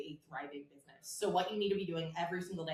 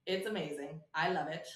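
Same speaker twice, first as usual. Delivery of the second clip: usual speaking voice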